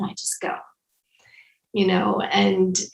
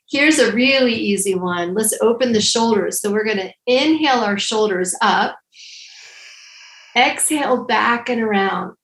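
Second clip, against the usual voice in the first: guided breathing instructions. Acted. Leads shoulder breathing exercise.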